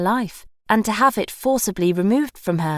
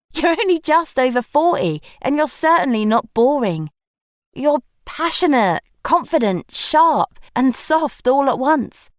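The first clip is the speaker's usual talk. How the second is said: animated protest; 7 semitones higher, 4 dB louder than usual. A narrator's reading. Gemma protesting, after laughing.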